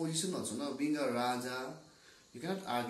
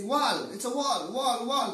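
higher, louder repeated insistence; arguing loudly; 8 semitones higher, 6 dB louder than usual. Acted. Blind man shouting over the others, arguing loudly. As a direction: keep hammering the same word, voice raised. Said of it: "shout grew louder and louder".

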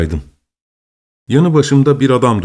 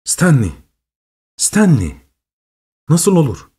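rising, incredulous repeated name; dawning astonishment; 4 semitones higher than usual. A narrator's reading. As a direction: realise something impossible, voice climbing higher. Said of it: "Sonra birden hayrete düştüm". (hayretle yükselen, inanamayan ses)

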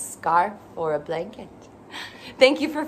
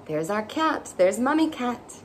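plain reading voice

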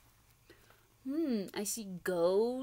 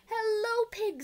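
much higher, louder greeting; Duck calling hello; 12 semitones higher, 6 dB louder than usual. Acted. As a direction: call out as Duck. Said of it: "said Duck".

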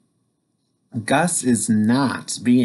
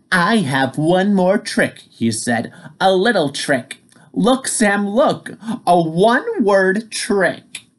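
teasing firefly; 7 semitones higher than usual. Acted.

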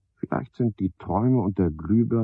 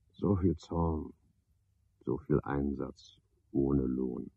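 softer, slowed reflection; quiet, drawn-out rumination; slow, quiet musing; 4 semitones lower, 7 dB quieter, slower than usual. Acted. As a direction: ponder it half to yourself. Watching the fly at the lamp.

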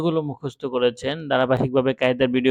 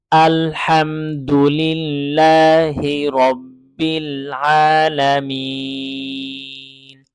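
slow melodic recitation of আলহামদুলিল্লাহি রব্বিল আলামিন; full verse recited; much slower than usual. Shown as a demonstration. Teacher recites the full verse after spelling it out, melodic recitation. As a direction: chant the whole verse smoothly, long vowels. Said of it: "এখন পূর্ণ আয়াত পড়ব".

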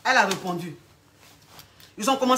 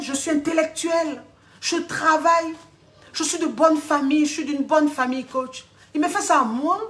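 higher-pitched, emphatic listing of merits; indignant self-praise; 4 semitones higher than usual. Acted. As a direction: plead wounded pride to the coach. Client lists her merits, wounded pride.

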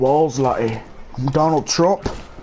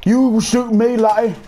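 put-on high-pitched accented protest; mock outrage; 7 semitones higher, 3 dB louder than usual. Gamer accusing Lottie of shooting him, mock outrage. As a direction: pitch it up, playfully affronted.

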